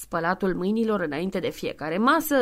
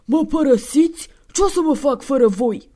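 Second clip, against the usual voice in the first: put-on high, loud, desperate old-man voice; dismayed old man; 5 semitones higher, 8 dB louder than usual. Santa begs the two not to leave him. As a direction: plead like an abandoned old man.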